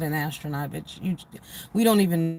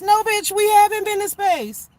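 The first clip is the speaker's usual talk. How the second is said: high, loud exasperated comeback; indignant retort; 12 semitones higher, 5 dB louder than usual. Acted. Answering the smug space question, loud and indignant. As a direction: fire it back, high and loud.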